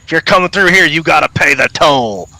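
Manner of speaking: high-pitched crossbow bandit voice; threatening toll-keeper. Acted. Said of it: "you hear a voice say".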